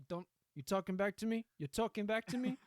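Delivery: loud, high mobster challenge; hammed-up indignant Don; mock mob threat. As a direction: confront him as an offended mob boss.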